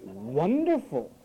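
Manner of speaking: WONDERFUL drawn out slowly with a heavy WON; slow mock WONDERFUL, first syllable stretched; emphasized first syllable. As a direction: lean long and hard on the WON of WONDERFUL. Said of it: "they emphasize the first syllable".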